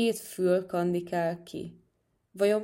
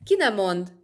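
loud, indignant burst; storybook shout of protest; 2 semitones higher, 8 dB louder than usual. A narrator's reading. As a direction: cut in loudly to forbid it. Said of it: "kiáltotta a nyúl".

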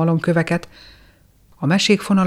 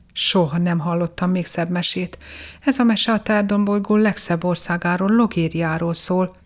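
ordinary delivery, nothing marked

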